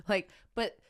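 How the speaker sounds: usual speaking voice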